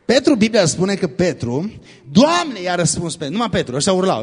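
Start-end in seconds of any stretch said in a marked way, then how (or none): 2.1-2.6 high-pitched call to the Lord; raised, drawn-out appeal. Acted. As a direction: act Peter crying out over the water. Peter calling to Jesus on water.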